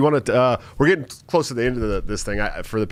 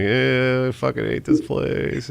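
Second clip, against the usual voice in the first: mad smiling; 4 semitones higher, much slower than usual. Retold or imitated. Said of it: "like, mad smiling".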